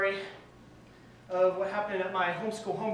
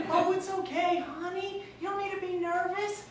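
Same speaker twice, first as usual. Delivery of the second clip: much higher fatherly coo; high-pitched dad; 10 semitones higher, 5 dB louder than usual. Retold or imitated. Dad soothing son on the phone, high mock coo. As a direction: reassure the nervous son in a squeaky voice. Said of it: "speaking high-pitched and everything".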